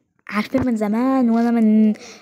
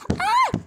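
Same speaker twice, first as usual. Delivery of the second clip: high yelp; slower than usual. Pretend. Shriek during play fight.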